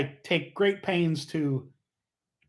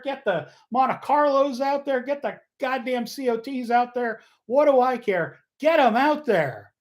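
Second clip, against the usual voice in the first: gleeful rant; 7 semitones higher than usual. Real, not acted. Host riffing to listeners about old drivers, gleefully worked up. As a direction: pitch it up and revel in the bit. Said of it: "I'm having too much fun with this".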